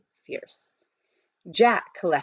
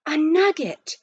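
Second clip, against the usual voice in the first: excited gasp; 9 semitones higher than usual. A narrator's reading. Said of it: "made him gasp".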